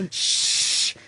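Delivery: a plain whispered shush; shushing sound. Retold or imitated. Retelling how he shushed subway riders, whispered. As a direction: shush a packed subway car.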